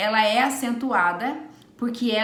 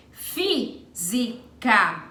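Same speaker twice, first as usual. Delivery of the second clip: slow deliberate reading; much slower than usual. Shown as a demonstration. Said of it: "teacher reads answer option".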